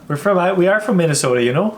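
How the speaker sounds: hammed-up Minnesotan accent, lilting up; thick Minnesota nice accent. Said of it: "people literally talk like this".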